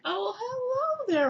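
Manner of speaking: very high, slow drawn-out greeting; exaggerated high-society hello; mock rich lady. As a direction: greet grandly in a put-on high voice. Rich woman answers door, acted.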